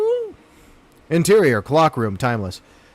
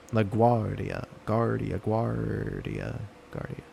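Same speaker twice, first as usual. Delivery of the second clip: fading echo, each repeat softer; 6 semitones lower, 11 dB quieter than usual. Pretend. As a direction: let the name echo away into nothing. Echoing helicopter call sign.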